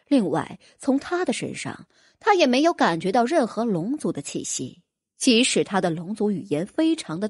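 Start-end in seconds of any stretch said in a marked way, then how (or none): none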